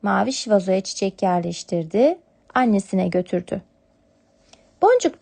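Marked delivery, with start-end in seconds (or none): none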